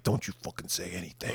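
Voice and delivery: low, very soft threat; imitating a creep's hushed threat; menacing hush. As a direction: hiss the threat low and quiet. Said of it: "he's like".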